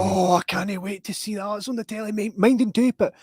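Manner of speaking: high-pitched eager mum voice; high excited voice. Retold or imitated. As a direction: squeal it like a thrilled mum. Voicing excited family member.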